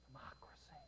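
a sly, secretive whisper. Acted. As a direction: breathe the word like a conspiracy. Rebels' secret demand.

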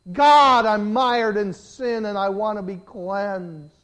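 loud, high, impassioned cry to God; shouted pulpit prayer, building in intensity; desperate prayer. Acted. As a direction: plead at full force, drawing out the words. Preacher models desperate prayer to God.

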